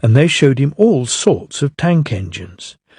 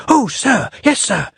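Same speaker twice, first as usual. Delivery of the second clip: eager Percy; 9 semitones higher than usual. A narrator's reading. Eager little engine answers.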